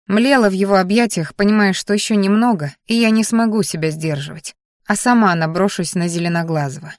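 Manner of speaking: ordinary delivery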